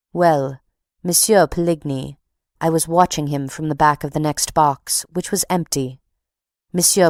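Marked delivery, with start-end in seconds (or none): none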